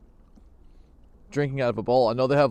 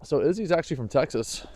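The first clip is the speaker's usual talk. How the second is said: ordinary talk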